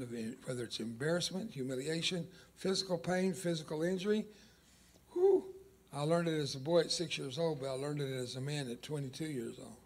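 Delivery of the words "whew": high whistling whew. Real, not acted. Exhale after heavy memory.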